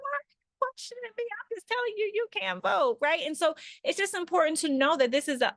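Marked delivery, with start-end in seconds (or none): 0.0-3.0 high, thin mock sob, much higher and softer; mock crying. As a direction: imitate someone whining tearfully to deflect. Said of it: "cry and be like".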